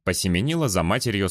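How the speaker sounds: ordinary talk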